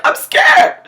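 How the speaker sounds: high, loud squeal through laughter; laughing, hammed-up panicked cry; mock terrified yell. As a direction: imitate a frightened man while cracking up. Repeats frightened man's cry, laughing.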